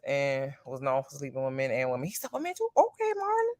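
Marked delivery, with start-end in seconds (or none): 2.1-3.5 high, soft, surprised gasp of a question; a pleased hint of shock. Real, not acted. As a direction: raise it up in scandalized disbelief. Surprised at gossip.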